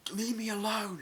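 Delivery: mock cry in a high voice; high-pitched plea. Retelling a film scene to viewers, high-pitched mock protest.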